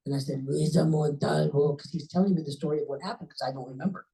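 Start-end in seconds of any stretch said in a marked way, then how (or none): 0.5-1.8 slow, drawn-out retold name; dazed victim imitation. Crash victim giving her name to rescuer, dazed and slow. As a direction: imitate a groggy answer, stretching each word. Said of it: "I don't talk like that".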